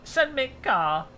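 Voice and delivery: high-pitched cheeky call for a freebie; pretend plea to carmakers. Streamer imagining asking Porsche for a free car, cheeky high-voiced plea. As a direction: pitch it up like asking pals a favour. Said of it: "They might oblige if I say".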